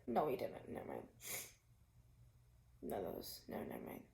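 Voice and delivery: breaking into tears, words half whispered; real tears choking the words out; crying. Real, not acted. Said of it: "I'm crying on social media again".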